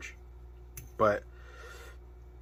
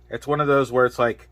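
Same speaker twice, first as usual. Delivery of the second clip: usual speaking voice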